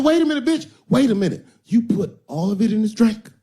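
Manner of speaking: high, loud disbelieving yelp; alarmed pimp. Acted. Pimp realizing she poisoned the john, high alarmed yelp. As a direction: shriek as it dawns on him. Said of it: "He said".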